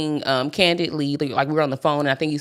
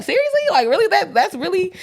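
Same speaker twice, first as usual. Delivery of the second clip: much higher, laughing incredulous voice; mock disbelief; 13 semitones higher, 4 dB louder than usual. Her reacting to boyfriend's complaint, high laughing disbelief. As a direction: squeal it in amused disbelief. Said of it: "I was like".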